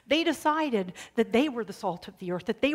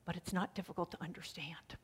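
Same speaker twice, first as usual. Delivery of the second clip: whispered aside; 2 semitones lower, 10 dB quieter than usual. Acted. Preacher confiding to the congregation in a whisper. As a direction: drop to a whisper.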